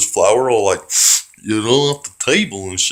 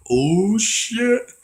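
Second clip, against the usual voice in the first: put-on stunned old man; 12 semitones higher than usual. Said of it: "he gets up and he's like".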